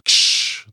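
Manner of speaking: hissed crunch noise; crushing sound. Pretend. Vial is destroyed, hissed crunch sound. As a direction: spit out a crushing ksh.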